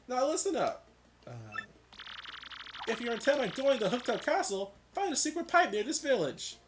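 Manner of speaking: mayor voice. Acted. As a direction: play the chatty old mayor. Mayor character read in a voice.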